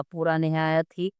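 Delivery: ordinary delivery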